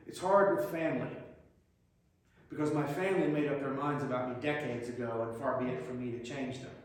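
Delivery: usual speaking voice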